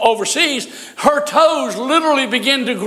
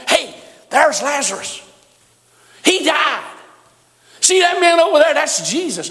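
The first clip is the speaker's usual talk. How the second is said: excited crowd voice; 5 semitones higher than usual. Retold or imitated.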